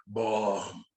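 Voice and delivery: low, slow mock villain grunt; deep-pitched, halting caricature; Bane imitation. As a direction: mimic a dim brute, drawn out and low.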